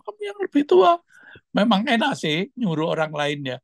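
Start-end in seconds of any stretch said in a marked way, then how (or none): 0.0-1.0 much higher, louder coaxing push; pushy friends urging. Retold or imitated. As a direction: egg him on like a nagging crowd of friends. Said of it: "teman-teman selalu mendesak".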